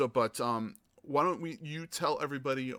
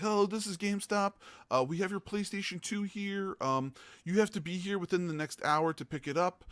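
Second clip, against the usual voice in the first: GameStop caller; 8 semitones higher than usual. Retold or imitated. Mimicking GameStop caller on phone.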